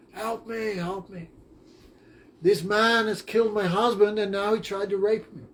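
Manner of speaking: a lightly voiced woman crying for help; a retold false alarm to the villagers; mock cry for help. Woman falsely calling villagers for help, mock distressed.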